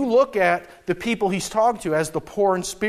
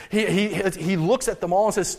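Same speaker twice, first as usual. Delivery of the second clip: ordinary talk, nothing marked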